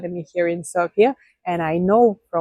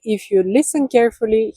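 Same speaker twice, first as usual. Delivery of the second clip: usual speaking voice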